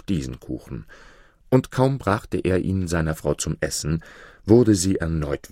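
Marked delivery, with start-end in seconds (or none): none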